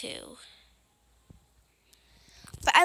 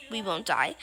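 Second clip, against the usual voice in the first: usual speaking voice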